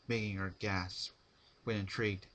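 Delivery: plain reading voice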